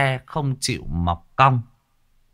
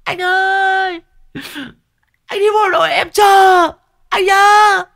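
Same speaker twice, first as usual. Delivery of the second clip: mock falsetto wailing, far higher; crying girlfriend; 9 dB louder than usual. Mocking a crying girlfriend seeing a soldier off, falsetto wail. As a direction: shriek it like a sobbing girl left behind. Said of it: "có cái con bé nó khóc".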